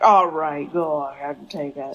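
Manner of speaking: mock reluctant sigh of consent; low, dropping off as if worn down; resigned giving-in. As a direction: give in grudgingly, imitating him. Sam finally gives in, retold.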